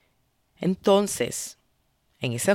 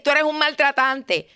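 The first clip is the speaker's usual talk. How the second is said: higher, pointed blaming tone on tú; accusing; 7 semitones higher than usual. Shown as a demonstration. Showing the wrong way, a higher accusing jab. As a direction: jab the word tú at them. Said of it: "En vez de decir".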